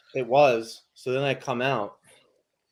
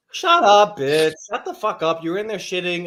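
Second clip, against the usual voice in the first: loud, raised angry retort; yelling back; 5 semitones higher, 8 dB louder than usual. Retold or imitated. Yelling back at the lady in the coffee shop. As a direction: snap back hard and loud. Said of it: "I'm like".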